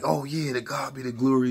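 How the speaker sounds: high, loud joyful praise; hyped. Retold or imitated. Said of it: "But I'm hyped".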